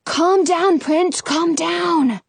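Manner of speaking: usual speaking voice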